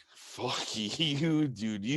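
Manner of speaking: laughing through the words. Real, not acted. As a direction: swear while laughing. Laughing at friend's teasing.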